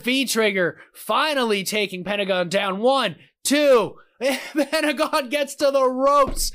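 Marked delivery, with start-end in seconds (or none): 2.8-3.9 loud, high pin count; shouted count. Real, not acted. Counting the pinfall. 4.1-6.5 high, slow, laughing disbelief. Real, not acted. Laughs at the kickout.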